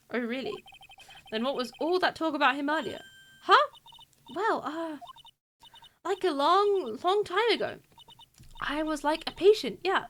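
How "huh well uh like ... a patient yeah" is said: bubbly airhead voice, higher; spacey like-filled chatter; ditzy valley girl. Acted. Medium girl greeting the lawyer, ditzy and higher. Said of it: "people who used to talk like this".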